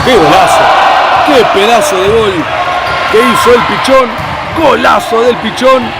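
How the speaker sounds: drawn-out shouted goal call, high; breakneck goal commentary. Real, not acted. Futsal goal scored, commentator erupts. (grito de gol eufórico y alargado)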